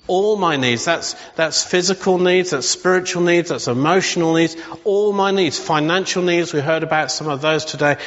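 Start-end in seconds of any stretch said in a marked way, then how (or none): none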